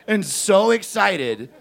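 normal talking voice

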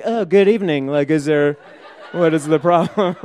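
acting out a winded, overly polite hello; retold breathless greeting. Shirtless teenager answering cops at the door, mock-breathless. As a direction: feign innocence while short of breath. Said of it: "like, breathless and shirtless".

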